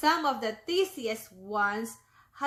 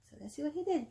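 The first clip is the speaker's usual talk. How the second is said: plain reading voice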